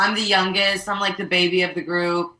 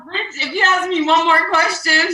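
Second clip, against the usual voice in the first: raised, higher mock warning; mock exasperated threat; 9 semitones higher, 5 dB louder than usual. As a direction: snap back in fake annoyance. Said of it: "I'm like".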